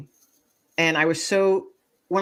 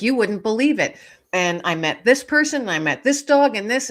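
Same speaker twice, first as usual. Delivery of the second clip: higher-pitched, eager retelling of a dog's news; super excited; 5 semitones higher, 4 dB louder than usual. Retold or imitated. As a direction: voice an excited dog sharing everything. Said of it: "he's super excited".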